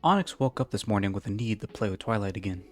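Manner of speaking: usual speaking voice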